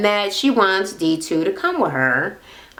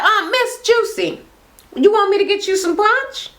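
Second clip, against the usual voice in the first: grandma's sweet offer; 9 semitones higher than usual. Retold or imitated. Grandmother offering punch, sweet high voice. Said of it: "That make me laugh. My grandma talk like that".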